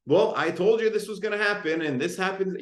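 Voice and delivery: frustrated reasoning. Retold or imitated. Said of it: "reason with my tantruming toddler".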